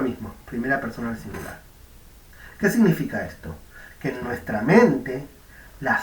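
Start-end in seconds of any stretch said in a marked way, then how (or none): none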